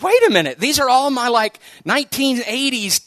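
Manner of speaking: high, loud startled double-take; shocked, like it just hit you; wait, am I getting old; sudden alarmed realization. Retold or imitated. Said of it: "And I was like".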